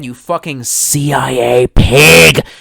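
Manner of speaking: hammed-up slow rage yell; mock furious yell. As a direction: bellow it like a mock tirade. Mock outburst at chat.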